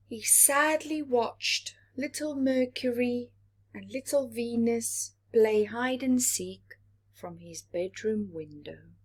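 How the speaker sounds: ordinary delivery, nothing marked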